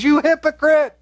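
a sharp cry of divine reproach; rebuking hypocrite. Retold or imitated. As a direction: call them out as God would. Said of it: "God says".

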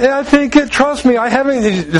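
high, plaintive mock wife; acted-out reluctant wife; imitated pleading wife. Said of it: "She's going".

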